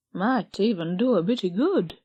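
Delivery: ordinary delivery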